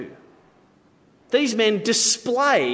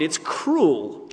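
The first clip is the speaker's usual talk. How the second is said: ordinary delivery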